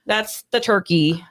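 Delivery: ordinary delivery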